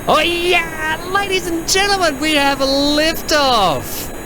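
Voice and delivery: high, slow triumphant announcer cry; excited announcer. Real, not acted. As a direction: announce liftoff like a showman. Rocket launch announcement.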